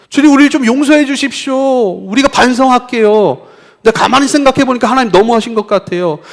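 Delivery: high, loud indignant appeal; pleading protest. Acted. Israelites asking why God acts like a stranger, pleading in protest. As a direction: cry out to God in complaint.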